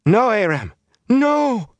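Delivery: very high, anguished cry; acted scream of alarm; horrified scream. A narrator's reading. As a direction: cry out in horror to stop him. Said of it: "she screamed as if that blade had gone into her flesh".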